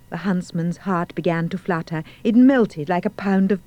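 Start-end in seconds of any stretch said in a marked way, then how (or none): none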